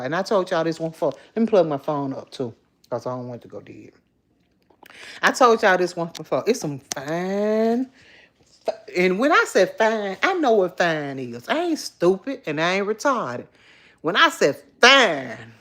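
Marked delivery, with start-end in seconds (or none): none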